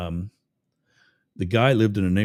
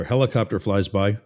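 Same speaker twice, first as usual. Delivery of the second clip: usual speaking voice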